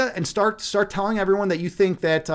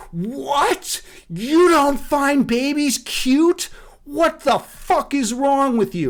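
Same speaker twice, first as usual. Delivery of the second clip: mock-outraged mother, higher and slow; 4 semitones higher, much slower than usual. Mothers swarm in on the streamer's post, mock outrage. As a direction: ham up an indignant mom. Angry mothers voiced in story.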